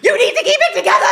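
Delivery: high laughing yell, fast; mock scream. Retelling how she yelled at Gracie down the phone, laughing. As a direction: shriek it through laughter. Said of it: "She starts screaming".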